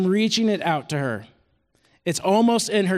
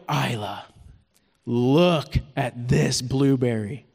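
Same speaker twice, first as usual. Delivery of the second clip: put-on slow, lower and hushed, coaxing a toddler to look; tender to a toddler; 7 semitones lower, slower than usual. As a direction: draw her in gently with a low, slow voice. Dad shows toddler a special blueberry.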